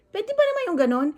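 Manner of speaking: high, loud, rushed exasperation; outraged question. Real, not acted. Vlogger venting to viewers about the lechon shop, outraged. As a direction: fire it off in disbelief.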